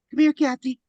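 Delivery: loud high call to a dog; mock shouting a dog's name. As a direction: yell across the yard for the dog. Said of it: "Calling the fucking dog".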